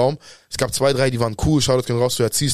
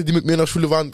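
ordinary talk

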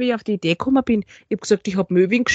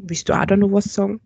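normal talking voice

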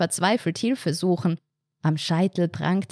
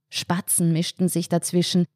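ordinary delivery, nothing marked

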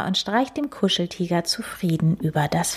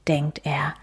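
ordinary delivery

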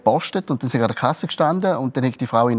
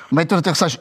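usual speaking voice

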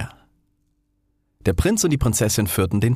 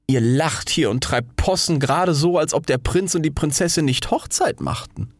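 high, pompous, self-important voice; a vain firework lecturing the others from above; haughty rocket; 7 semitones higher than usual. Acted. Rocket scolds the other fireworks, high and pompous.

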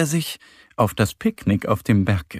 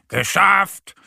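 raised, loud triumphant call; joyful shout; 7 semitones higher, 5 dB louder than usual. A narrator's reading. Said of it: "ruft er freudig". (freudig und laut gerufen)

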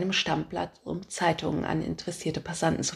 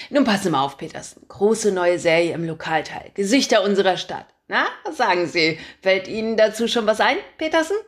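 loud, brash editor barking orders; a pushy chief editor who loves his own voice; booming boss; 4 dB louder than usual. Acted. Chief editor calling his reporter, booming and pushy.

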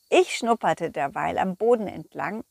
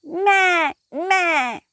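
high goofy bleating; sheep bleat; 7 semitones higher, 4 dB louder than usual. Acted.

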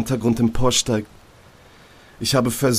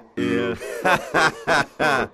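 inner voice laughing; 5 semitones higher than usual. Acted.

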